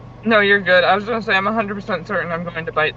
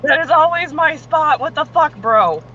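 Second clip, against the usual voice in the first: indignant; 8 semitones higher, slower than usual. Real, not acted. Someone took her spot.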